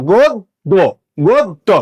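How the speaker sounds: গোদ, দো broken in two, closing the first on দ; doubled-consonant pronunciation. Shown as a demonstration. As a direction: say গোদ, then দো, the দ heard twice. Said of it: "দটা দুইবার উচ্চারণ হলো". (দ দুইবার, ভেঙে উচ্চারণ: গোদ, দো)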